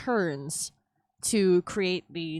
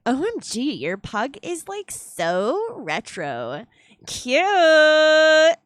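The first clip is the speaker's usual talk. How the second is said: put-on hoog, lome Valley Girl-stem met gilletje op cute; 10 semitones higher, 8 dB louder, much slower than usual. Shown as a demonstration. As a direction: speel een overdreven Valley Girl. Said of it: "I guess you would say O M G in this case".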